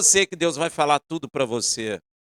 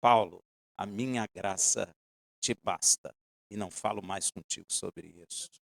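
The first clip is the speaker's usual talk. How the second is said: God ending Paul's plea, low and quiet; God's voice; 8 semitones lower, 7 dB quieter than usual. Acted. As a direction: lower and softer, final. Voices God closing the matter.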